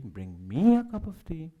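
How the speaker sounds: "me" lifted high above the rest; stress on ME. Shown as a demonstration. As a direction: put the accent on "me". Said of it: "where you put the accent".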